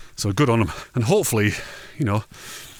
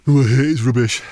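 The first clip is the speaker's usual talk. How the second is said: mock grumbling; 3 semitones higher, 5 dB louder than usual. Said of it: "like I do all the time".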